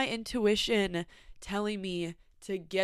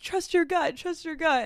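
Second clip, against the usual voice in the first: higher-pitched mock advice-giver; people's advice; 8 semitones higher, 3 dB louder than usual. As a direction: parrot the advice in a raised voice. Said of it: "people are like".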